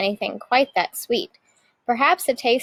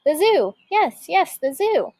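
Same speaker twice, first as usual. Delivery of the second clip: thrilled idea; 6 semitones higher than usual. A narrator's reading. Witch thrilled by her idea.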